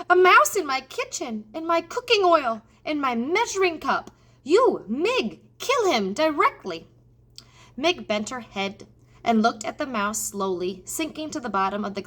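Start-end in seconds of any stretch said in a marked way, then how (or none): 0.1-6.9 high, loud indignant outburst; outraged cook. Acted. Cook ordering Mig to kill the mouse, outraged and shrill. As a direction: rail at the mouse in your kitchen.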